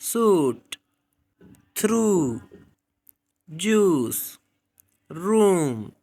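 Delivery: teacher demo of long oo; long oo words. Shown as a demonstration. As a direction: stretch the oo in थ्रू. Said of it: "For example".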